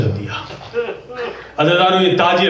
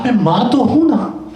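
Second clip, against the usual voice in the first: higher, ہوں leaned on with a falling tune; heartfelt repeat; 5 semitones higher than usual. Shown as a demonstration. Preacher repeats the grieving mother's line, lifted. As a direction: lift the mother's words up and let ہوں fall. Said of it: "کہا".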